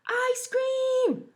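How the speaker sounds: very high, loud shout; yelling. Acted. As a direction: call it out across the playground. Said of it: "yelled Waffle".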